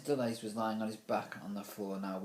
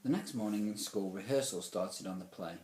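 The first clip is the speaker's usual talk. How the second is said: plain reading voice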